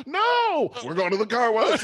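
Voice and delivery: put-on high, loud wailing little girl; crying child. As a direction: wail it like a terrified kid. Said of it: "she'd be crying too".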